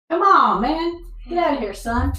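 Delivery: high, loud playful shoo; shooing call. Real, not acted. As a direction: chase him out, calling high. Shooing someone out of the room.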